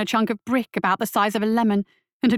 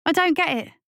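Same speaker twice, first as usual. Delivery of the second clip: put-on higher young boy, impatient; irritated boy; 8 semitones higher than usual. A narrator's reading. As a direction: brush it off like a cocky kid. Lasky voiced as boy.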